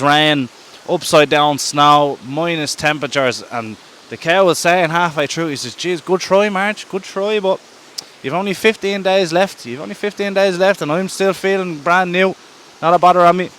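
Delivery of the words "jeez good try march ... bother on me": higher-pitched, gloating cow voice; playful mock-congratulating brag; cocky taunting. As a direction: crow at a beaten rival, unbothered. Cow taunts March in story.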